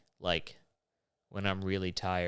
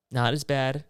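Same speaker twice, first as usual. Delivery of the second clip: louder, stressed "as" in "Not as bad"; emphatic; 4 semitones higher, 6 dB louder than usual. Shown as a demonstration. Comic correcting himself, punches "as" in "Not as bad". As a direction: lean hard on "as" in "Not as bad". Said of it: "Still annoying?".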